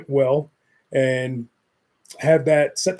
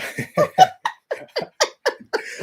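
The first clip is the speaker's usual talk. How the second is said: high laugh; 4 dB louder than usual. Real, not acted. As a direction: burst of high-pitched laughter. Laughs at losing the listener.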